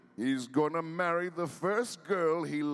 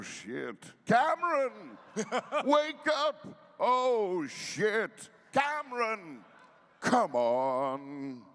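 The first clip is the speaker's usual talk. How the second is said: urgent calling out; 8 semitones higher, slower than usual. Acted. Trying to wake drowned friend.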